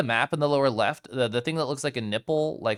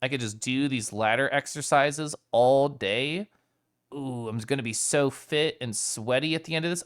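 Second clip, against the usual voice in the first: usual speaking voice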